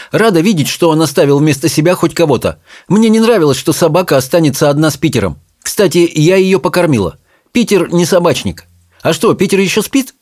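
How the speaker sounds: high-pitched, businesslike witch; enterprising witch mother. Acted. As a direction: voice a brisk-minded mother well above the narration.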